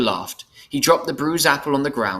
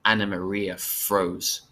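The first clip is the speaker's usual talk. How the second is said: ordinary delivery, nothing marked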